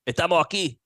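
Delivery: put-on Spanish words with a Cuban accent. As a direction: say it like a Miami Cuban. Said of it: "do the whole thing in your Cuban voice".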